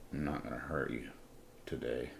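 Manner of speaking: soft, low mock-gentle threat; quiet menace. Homeowner holding the city man by the shirt, quietly menacing. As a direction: drop the voice, menacingly calm.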